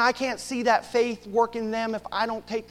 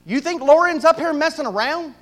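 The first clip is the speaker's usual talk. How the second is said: raised, pointed question from God; God's rebuke; 10 semitones higher, 10 dB louder than usual. Retold or imitated. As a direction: voice God calling him out, a touch higher. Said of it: "That's what God told me".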